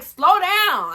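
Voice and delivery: high, loud snap; telling him off. Retold or imitated. Retelling yelling at boyfriend during the screen share, high and loud.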